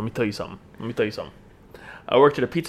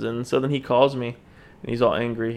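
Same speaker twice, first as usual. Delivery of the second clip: ordinary talk, nothing marked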